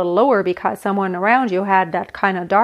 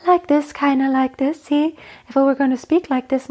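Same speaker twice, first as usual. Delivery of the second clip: head voice; 4 semitones higher than usual. Shown as a demonstration. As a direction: speak up high in light head voice. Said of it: "she always would go in head voice".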